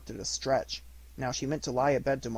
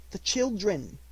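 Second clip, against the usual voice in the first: Bob's gentle plea; 9 semitones higher, slower than usual. Acted. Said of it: "said Bob".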